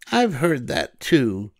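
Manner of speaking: high, cheerful storybook voice for Amelia; Amelia Bedelia voice. A narrator's reading. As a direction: lift into a bright girlish character voice. Amelia replies.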